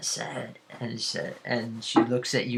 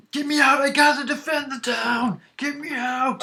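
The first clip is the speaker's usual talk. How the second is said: high, frantic miner's cry; panicked yelling; 13 semitones higher, 4 dB louder than usual. Acted. Restrained miner begging to be freed, high panicked yell. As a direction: plead loudly and high to be freed.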